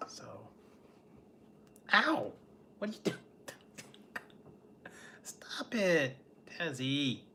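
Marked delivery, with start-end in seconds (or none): 1.9-2.3 sudden ow. Real, not acted. Cat bites her. 2.8-6.1 a giggly unvoiced whisper to the cat; laughing whisper. Real, not acted. Owner to misbehaving cat, laughing whisper.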